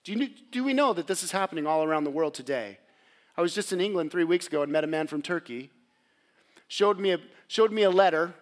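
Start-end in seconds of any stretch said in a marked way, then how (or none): none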